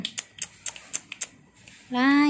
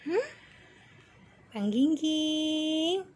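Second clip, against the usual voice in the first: normal talking voice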